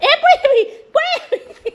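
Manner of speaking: startled cry then a laugh; laughing shout of warning to the dog; alarmed laughing shout. Real, not acted. Dog about to fall.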